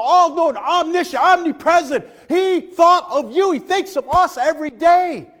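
loud, high, exultant preaching; full-force preaching. Real, not acted. Preacher telling young people God thought of them, full-force preaching. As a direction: build to a shouted sermon climax.

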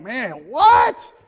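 mock disbelief. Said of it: "some of us are like".

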